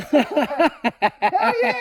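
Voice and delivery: very high, thrilled dog voice; ecstatic Malinois. Acted. Malinois wound up by a guest's pat, high thrilled squeal. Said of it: "then my Malinois goes".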